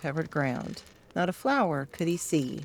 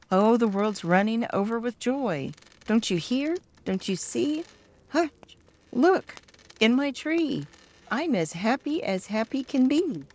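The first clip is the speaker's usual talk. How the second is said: bright, higher, joyful bird voice; joyful thrush; 6 semitones higher than usual. A narrator's reading. Thrush sings out its joy to the children, high and bright. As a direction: chirp it out like a happy bird.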